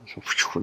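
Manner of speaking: soft whoosh of breath in relief; light, acted-out "phew"; relieved phew. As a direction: puff out a quick mock sigh. Same relief at second sin listed.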